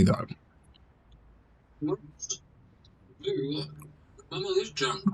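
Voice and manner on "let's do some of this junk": much softer, barely audible; very quiet. Real, not acted. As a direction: drop to a near-silent murmur. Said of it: "Can you actually hear me".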